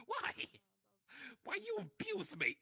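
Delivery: very high, slow whine; high whiny voice. Pretend. Mock complaint to cat.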